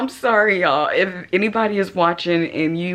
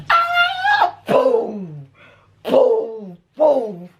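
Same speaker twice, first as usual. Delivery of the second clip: mock shooting; much slower than usual. Shown as a demonstration. Showing her son how she'd shoot the roach. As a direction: act out blasting the roach. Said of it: "I should have been like this".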